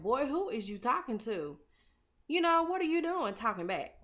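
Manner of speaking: high, loud mock telling-off; mock scolding. Tasha to her son who bucked at her, imitated scolding. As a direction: play an indignant mother putting a kid in his place.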